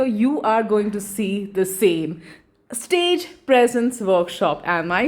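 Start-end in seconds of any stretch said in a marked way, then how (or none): none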